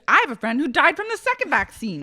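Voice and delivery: high, loud, rushed retort; mock heated claim. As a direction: blurt it loudly as if arguing. Acting out argument, raised fast voice.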